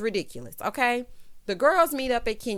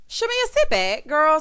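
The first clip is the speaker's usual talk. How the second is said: high, loud burst at the start; playful telling-off; 6 semitones higher, 5 dB louder than usual. Real, not acted. Host scolds Shamea to sit back, high and loud. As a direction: call her out in a raised, high voice. Mock telling-off of a cast member.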